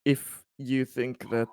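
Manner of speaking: ordinary talk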